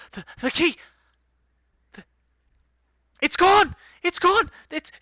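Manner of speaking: high, frantic panic over the lost key; panicked cry. Acted. NPC panicking to the crew about the lost key, frantic high cry. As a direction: shriek that it's gone.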